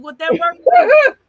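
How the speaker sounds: shoots way up, loud and slow; incredulous high squeal. Real, not acted. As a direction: squeal the question in disbelief. Asking about swallowing seeds.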